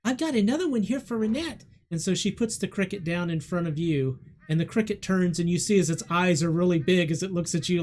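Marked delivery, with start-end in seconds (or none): 0.0-1.6 put-on little girl. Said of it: "And she goes".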